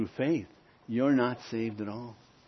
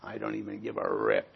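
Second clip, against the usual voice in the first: indifferent listener; 6 semitones lower, 6 dB quieter than usual. Acted. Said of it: "You might be thinking".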